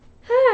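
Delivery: big weary exhale; loud sigh. Real, not acted. Tired sigh while sewing.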